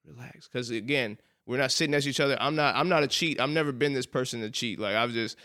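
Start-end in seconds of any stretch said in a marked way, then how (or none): 0.1-0.5 hushed, barely voiced relax; chill friend. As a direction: murmur it calm and sure. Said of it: "And he says".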